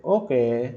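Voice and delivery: "ओके" with the last vowel drawn out; long, lingering "ओके"; tone demo. Shown as a demonstration. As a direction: stretch "ओके" slowly to show a feeling. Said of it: "आप feeling कैसे".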